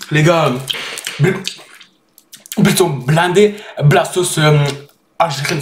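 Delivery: talking between bites; breathless after spicy food; mouth full, chewing. Real, not acted. Seen 2: Small webcam; hand at mouth, bottle sipped, taco wrap held after.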